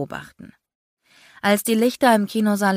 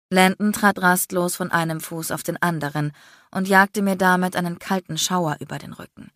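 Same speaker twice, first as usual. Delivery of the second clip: usual speaking voice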